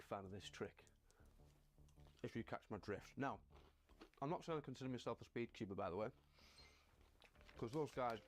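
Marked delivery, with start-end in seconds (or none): 5.2-8.2 talking with mouth full of chocolate; chewing chocolate while talking. Real, not acted. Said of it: "sorry, got chocolate in my mouth".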